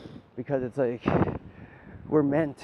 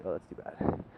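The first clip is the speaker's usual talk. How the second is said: whispered mock pity; 6 semitones lower, 7 dB quieter than usual. Quoting indifferent onlooker.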